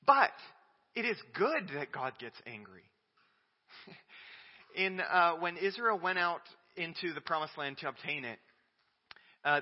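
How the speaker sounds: ordinary talk, nothing marked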